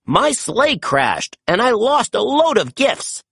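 a hint of a troubled old Santa, slowed at the start; lightly voiced Father Christmas in trouble; Santa Claus. A narrator's reading. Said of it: "Santa said".

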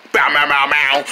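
low guitar imitation; bow-bow out a riff in a low voice; mouthed guitar riff. Player rocking out on guitar, low mouthed riff.

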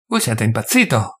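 plain reading voice

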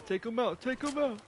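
high-pitched, repeated urging; urgent callout. Real, not acted. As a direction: urge a teammate on, pitch climbing. Urging teammate during the game.

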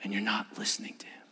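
trailing off into an unvoiced whisper; fading whisper. Shown as a demonstration. Showing noise drowning God out, fading to a whisper. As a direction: let the voice disappear. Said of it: "you can't hear God".

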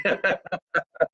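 high chuckling laugh; amused laugh. Real, not acted. Comedian laughing off a viewer's jab, chuckling high. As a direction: keep chuckling at the joke on you.

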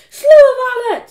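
put-on very loud, high bossy girl; bossy command. A narrator's reading. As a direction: correct the servant at full volume. Margaret bossing her servant.

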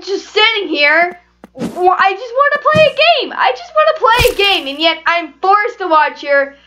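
high-pitched, exasperated complaining; infuriated rant. Real, not acted. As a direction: rant at the game, pitch climbing into a whine. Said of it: "I'm so infuriated".

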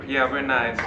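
ordinary talk

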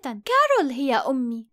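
plain reading voice

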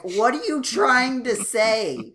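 loud, high-pitched plea to the show; mock exasperation. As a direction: draw out an exasperated demand, hammed-up. Exasperated questions addressed to the show.

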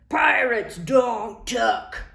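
slow, loud gruff declaration; bellowing. Acted. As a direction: bellow it, offended. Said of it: "he bellowed".

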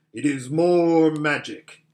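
loud, resounding lion's proclamation; great voice. Acted. Lion reveals himself to the girls, great voice. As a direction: declare it grandly, full-voiced. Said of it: "said a great voice".

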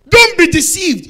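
loud preaching burst; fiery sermon cry; preaching at full force. Acted. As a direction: thunder it at the congregation.

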